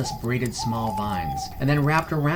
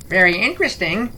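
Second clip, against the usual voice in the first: high, loud parroted echo; bird mimicry; 8 semitones higher, 6 dB louder than usual. The bird-man parrots Salas, high and loud. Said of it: "repeated My Name is Crow".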